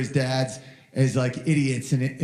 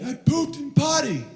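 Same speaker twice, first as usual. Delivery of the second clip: put-on much higher, proud kid's announcement; proud toddler announcing; 10 semitones higher than usual. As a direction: announce it like a triumphant little boy. Son proud of potty.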